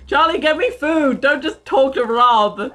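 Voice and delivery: calling out. Real, not acted. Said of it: "calling out for food".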